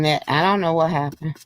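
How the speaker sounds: ordinary talk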